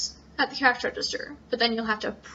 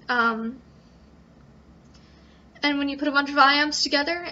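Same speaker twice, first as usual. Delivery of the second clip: normal talking voice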